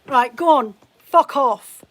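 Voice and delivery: loud, curt send-off; angry dismissal. Real, not acted. Streamer blocks a rude commenter, angry dismissal. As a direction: order them out, fed up and loud.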